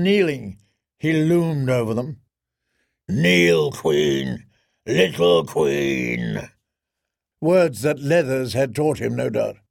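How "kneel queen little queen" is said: very low, very slow giant rumble; deep boulder voice of a giant; rumbling giant voice. Acted. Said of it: "voice rumbled like a boulder".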